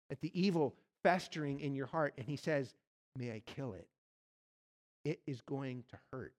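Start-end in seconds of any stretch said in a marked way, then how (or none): 3.2-6.3 much softer, low and gentle; Jesus asking the listener, soft and low; hushed question. Acted. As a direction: let it land, nearly hushed. Jesus voiced as angel.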